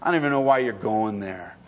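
low, offhand scoff from the devil; dismissive Satan. Acted. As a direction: wave them away in a low voice. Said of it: "have Satan come along and say".

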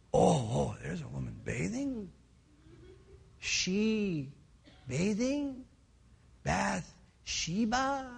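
slow, dawdling awe, as if spellbound; smitten ogling. Acted. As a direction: draw it out, hypnotized by the sight. King spots woman bathing.

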